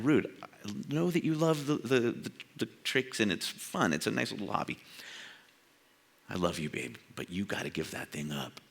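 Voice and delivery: soft, low, loving and gentle; tender Jesus voice. Pretend. Preacher voicing Jesus speaking tenderly to him. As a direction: lovingly tell him to give it up.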